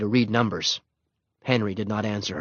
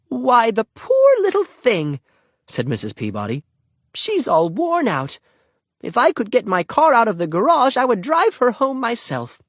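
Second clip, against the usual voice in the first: put-on pitying old lady; 12 semitones higher, 4 dB louder than usual. A narrator's reading. Missus Peabody pitying the crying Ramona, sympathetic. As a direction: coo over the poor child.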